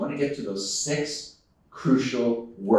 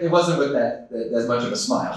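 ordinary delivery

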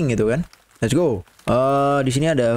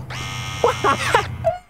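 nervous laugh. Real, not acted.

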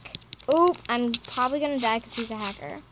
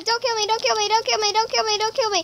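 high, rapid begging; pleading; 6 semitones higher, much faster than usual. Real, not acted. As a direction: beg over and over. Begging enemy in game.

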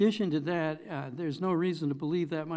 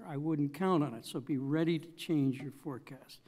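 normal talking voice

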